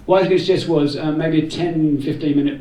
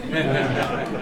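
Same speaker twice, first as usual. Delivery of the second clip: ordinary talk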